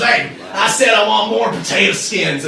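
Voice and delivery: high, loud goblin customer. Acted. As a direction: holler at the staff like a rowdy goblin. Redneck goblin demands food.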